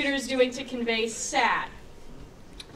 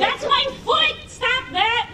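much higher, louder protest; laughing yell; 9 semitones higher, 4 dB louder, slower than usual. Real, not acted. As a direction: shout it while laughing. Suiters grabbing his foot.

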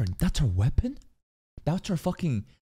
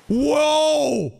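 high, loud, drawn-out whoa; shocked shout; 14 semitones higher, 7 dB louder than usual. Real, not acted. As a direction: cry out in real amazement. Shocked reaction to transformation.

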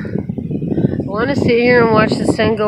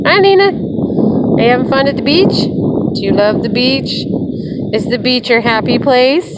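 pet talk; 5 semitones higher than usual. Real, not acted. Owner greeting her dog on the beach, cooing. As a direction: coo at the pup, pitch lifted.

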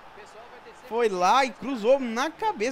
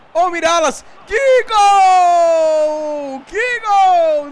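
goal shout; 9 semitones higher, 11 dB louder, much slower than usual. Real, not acted. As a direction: build to a scream. Goal build-up yelling.

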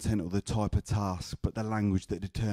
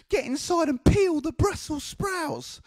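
very high, slow grandma screech; slower than usual. Retold or imitated. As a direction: order the child about in a drawn-out high voice. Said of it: "She sounded like this".

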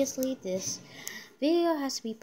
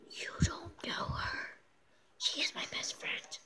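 whisper; 7 dB quieter than usual. Pretend. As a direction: lean in and whisper it. Secret aside in skit.

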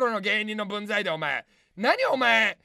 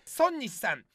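ordinary talk, nothing marked

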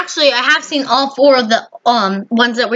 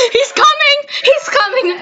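panicked warning; 13 semitones higher than usual. Real, not acted. Warning as the killer arrives.

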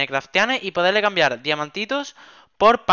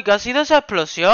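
normal talking voice

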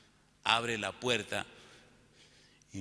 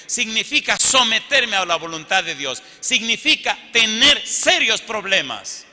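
loud, high, impassioned sermon delivery; forceful pulpit proclamation, loud and high; full-force preaching; 10 semitones higher, 15 dB louder than usual. Real, not acted. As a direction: preach it out with hammered key words.

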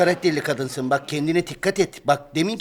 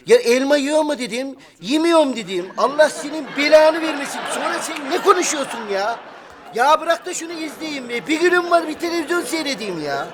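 angry shout; 7 semitones higher than usual. Acted. Husband yelling at nagging wife to let him watch TV, angry shout.

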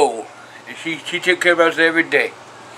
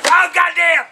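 genuine, exasperated yell; angry outburst; 10 semitones higher, slower than usual. Real, not acted. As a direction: explode at the mess in real anger. Cursing at the garbage mess.

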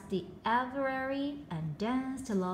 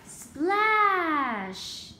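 louder, drawn-out splash; splash sound; 5 semitones higher, 5 dB louder than usual. A narrator's reading. The walrus chain ending in a splash, loud. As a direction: make the big splash land.

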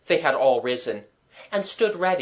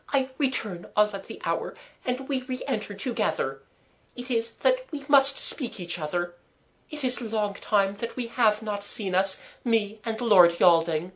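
higher-pitched governess voice, happy; Mademoiselle, happy French governess; 7 semitones higher than usual. A narrator's reading. Governess telling the children she will return, happy.

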